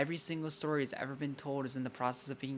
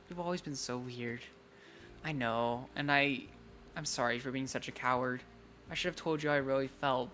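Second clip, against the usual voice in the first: usual speaking voice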